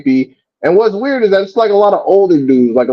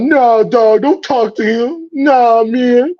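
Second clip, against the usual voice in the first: mocking a grown man; 10 semitones higher, slower than usual. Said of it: "talking about".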